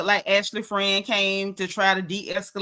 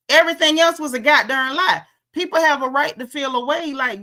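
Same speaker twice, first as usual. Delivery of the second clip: louder and higher, heated; fired-up outburst; 5 semitones higher, 5 dB louder than usual. Real, not acted. Host ranting about Stacey's lies, heated outburst. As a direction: slam it down like the last word.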